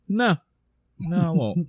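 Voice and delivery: lightly voiced old Steve, teasing and coy; coy refusal. Steve retold, coyly refusing to tell.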